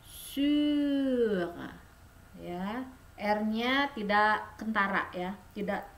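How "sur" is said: throaty r, rounded u; French sur demo. Shown as a demonstration. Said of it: "Jadi dibacanya sur".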